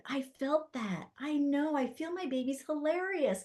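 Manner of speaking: a hint of an amazed mother, quiet and high. Mom freaking out, retold.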